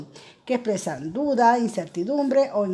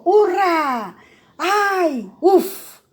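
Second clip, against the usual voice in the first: each cry higher and louder; exclamations acted out; 7 semitones higher, 7 dB louder, much slower than usual. Shown as a demonstration. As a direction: burst out with each interjection. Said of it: "si yo digo".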